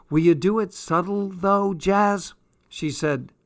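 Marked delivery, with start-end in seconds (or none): 0.1-2.3 higher, pleading question to a friend. A narrator's reading. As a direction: ask anxiously for a favour. Anxious request to a friend.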